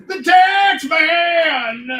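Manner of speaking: high, drawn-out heroic shout; superhero announcer. Acted. Creator revealing his invented superhero, long high announcer shout. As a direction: stretch the name out like a hero reveal.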